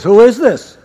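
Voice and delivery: loud demand to the caller; suspicious challenge. Retold or imitated. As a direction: challenge an unknown caller loudly. Acted phone answer, wary stranger.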